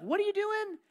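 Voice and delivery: curious stranger. Retold or imitated. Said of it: "they'd be like".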